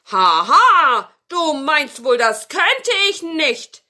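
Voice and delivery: loud villainous ha-ha, then boast; boastful wizard call. Acted. Wizard takes up grandmother's challenge, loud boastful call. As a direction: crow it loudly and scornfully. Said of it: "rief der Zauberer".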